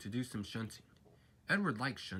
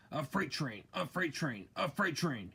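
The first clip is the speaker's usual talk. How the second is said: grumbling; 4 semitones higher than usual. A narrator's reading. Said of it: "he grumbled".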